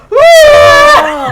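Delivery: retold high gasping scream; loud startled "Ah!"; shocked scream.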